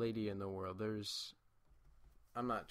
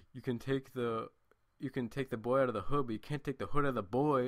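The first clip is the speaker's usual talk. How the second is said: louder, swaggering mock tough talk; like a boss; 2 semitones higher, 8 dB louder than usual. Teacher coming back in like a boss to the girl. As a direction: strut the line like a boss. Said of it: "he comes back in like a boss".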